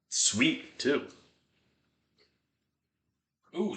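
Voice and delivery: normal talking voice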